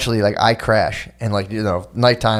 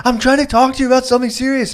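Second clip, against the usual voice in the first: much higher mock of the wife's complaint; imitating annoyed wife; 12 semitones higher, faster than usual. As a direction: pitch it up like the upset wife. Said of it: "She was like".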